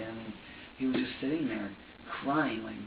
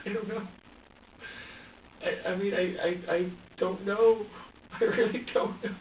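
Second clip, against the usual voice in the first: higher, strained and halting through tears; tearful; 4 semitones higher than usual. Real, not acted. Parent unsure what hurt the baby, tearfully distressed. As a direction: insist helplessly, voice rising and breaking up.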